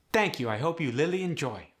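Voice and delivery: put-on Khmer-accented thanks, R softened to L; polite Khmer-accented sign-off. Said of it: "Soka, thanks for joining us".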